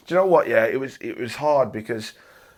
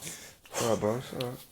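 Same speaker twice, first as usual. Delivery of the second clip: softer, hushed comfort to a crying friend; gentle reassurance; 2 semitones higher, 10 dB quieter than usual. Real, not acted. Host softly calming his guest who is close to tears, hushed and reassuring. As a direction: soothe him quietly, no rush.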